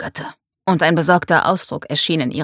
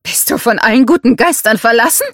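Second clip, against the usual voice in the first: mother's higher, louder angry question; incensed, raised-voice protest; heated outcry; 6 semitones higher, 6 dB louder than usual. A narrator's reading. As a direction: call out in heated disbelief. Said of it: "rief Mercedes hitzig".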